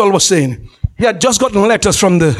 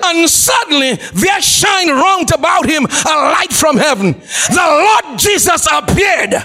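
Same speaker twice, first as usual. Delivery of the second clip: dramatic preaching; 5 semitones higher than usual. Real, not acted. Preacher telling of Saul struck down near Damascus, dramatic preaching. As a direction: proclaim the scripture with mounting drama.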